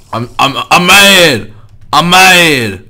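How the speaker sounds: hammed-up angry yell; playful fake tantrum; mock mad. Mock outburst at teammate.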